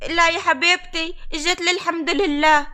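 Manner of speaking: normal talking voice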